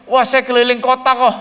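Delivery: lightly voiced child, casual and breezy; answering like a carefree kid who just toured; child's answer. Child tells the pastor he only toured the city, lightly voiced.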